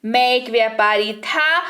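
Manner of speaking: usual speaking voice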